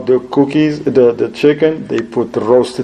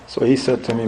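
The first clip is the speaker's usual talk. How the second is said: normal talking voice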